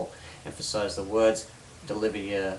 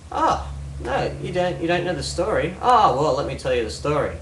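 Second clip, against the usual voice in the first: higher, animated mock surprise, easing down; confident storyteller; 6 semitones higher, 5 dB louder than usual. Hypnotist offering a confused listener the story, confidently animated. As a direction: launch eagerly into offering a story. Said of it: "before I was this confident, I go".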